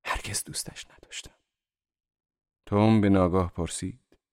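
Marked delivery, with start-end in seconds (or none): 0.0-1.4 barely voiced, reluctant admission. A narrator's reading. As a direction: let it out unwillingly, very softly. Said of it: "دیزی با بیمیلی تمام گفت".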